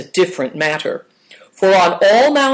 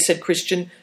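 ordinary delivery, nothing marked

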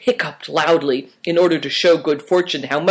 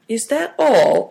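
higher, drawn-out disappointed question; 8 semitones higher, slower than usual. A narrator's reading. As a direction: ask slowly, let down.

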